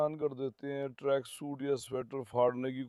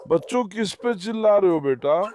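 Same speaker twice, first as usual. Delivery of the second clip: louder and higher, calling out; calling over the barking; 4 semitones higher, 10 dB louder than usual. Real, not acted. Owner scolding puppies that keep barking, loud call.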